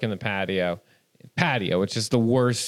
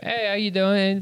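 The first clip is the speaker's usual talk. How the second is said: higher, lightly performed greeting; put-on audition greeting; 8 semitones higher than usual. Actor greeting casting in a put-on voice, higher. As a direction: pretend to walk into an audition. Acting out himself entering an audition.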